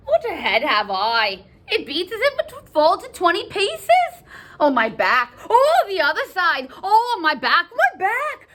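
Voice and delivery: theatrical moans rising into high cries; milk every ache for sympathy; wailing nurse. Acted. Nurse wails about head and back.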